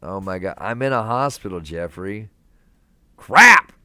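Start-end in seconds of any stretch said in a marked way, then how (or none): 3.2-3.7 yelling crap out loud in surprise; a real startled yell, much higher and louder; sudden shout. Real, not acted. Streamer yelps at a chat donation, a sudden loud shout. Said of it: "I didn't really scream it".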